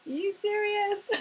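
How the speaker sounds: high, amused are you serious; mock him laughing at you; laughing disbelief. Husband laughing at his wife's story, amused and disbelieving. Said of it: "He's laughing at me".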